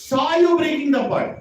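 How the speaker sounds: high, loud, quick accusation; mother catching the boy red-handed, calling it out; accusing mother. Acted. Mother confronts little Krishna over the broken pot, loud and high.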